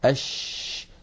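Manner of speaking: "أش" with the sh held long from mid-tongue; sustained hushing "أش"; spreading sheen. Shown as a demonstration. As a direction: let the sh in "أش" spread out against the teeth. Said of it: "يبدأ صوت الشين من مخرجه".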